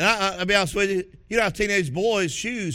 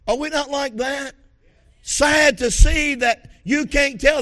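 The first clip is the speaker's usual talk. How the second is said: loud, fervent preaching; 5 semitones higher, 7 dB louder than usual. Real, not acted. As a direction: thunder it from the pulpit. Preaching at full force.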